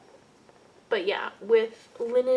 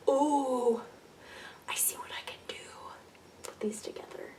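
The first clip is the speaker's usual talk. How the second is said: whispered thought; 3 semitones higher, 5 dB quieter than usual. Retold or imitated. Recalled inner thought while stash diving.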